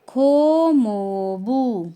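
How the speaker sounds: slow, clear Korean "खो मोबू", drawn out syllable by syllable; teacher modelling Korean "खो मोबू"; Korean term. Shown as a demonstration. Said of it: "तो उनको बोलते हैं".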